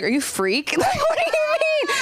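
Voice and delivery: high, startled squeak; mock suspicious. Comic answering the Uber driver, startled high squeak. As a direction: recoil at the strange question. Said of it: "And I was like".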